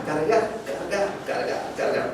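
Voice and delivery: slow repeats fading down to a whisper; fading loading mimic. Teacher mimicking a slow file load, fading to whisper. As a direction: let the word die away like a loading bar stalling.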